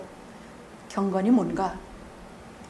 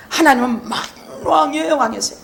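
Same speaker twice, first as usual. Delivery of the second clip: ordinary talk, nothing marked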